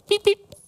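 very high little cheeps; playful squeaky chick imitation; chick cheeping. A narrator's reading.

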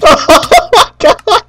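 very high, helpless laughing wail; real cracked-up disbelief; laughing dismay. Real, not acted. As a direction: squeal it through laughter. Laughing at own mishap.

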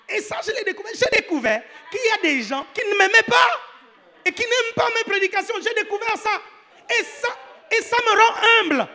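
loud, high-pitched comic exclamation; impassioned outcry. Real, not acted. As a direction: cry it out high and loud with amused exasperation. Comic confession of being humbled.

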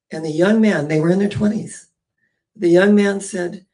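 ordinary talk

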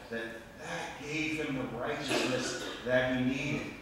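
ordinary talk

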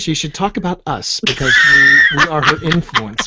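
ominous villain voice. Pretend. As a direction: announce it like a dark cult leader. Hammy villain boast about influence.

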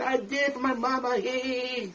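high, giddy victory cry; overjoyed champion. Retold or imitated. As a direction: shout it like you just won the belt. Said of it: "I was so happy".

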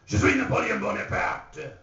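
grand proclamation. Acted. Patient stepping out of the room, proud loud proclamation. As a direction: proclaim it like a triumphant Napoleon. First patient emerging, still Napoleon.

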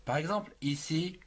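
usual speaking voice

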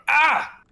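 sharp, loud yelp of pain; jolted by a jab to the wound; cry of pain. Acted. Xavier yelps as the doctor prods his wound.

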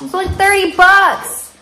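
high, loud delighted squeal at the price; excited high-pitched disbelief; amazed. Real, not acted. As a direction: squeal it out, can't believe the bargain.

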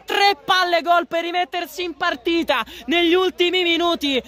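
high, urgent goal call; frantic near-miss commentary; breathless italian goal commentary. Real, not acted. Excited live play-by-play climax.

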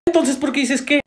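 exasperated. Acted. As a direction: snap back with mild exasperation. Acted squabble, first round.

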